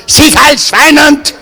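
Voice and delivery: high, slow, barked fake German; mock German shout. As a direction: bark it like a cartoon German officer. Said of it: "[name] walked in and said".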